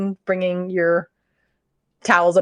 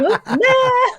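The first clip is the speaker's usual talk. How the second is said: laughing; 13 semitones higher than usual. Retold or imitated.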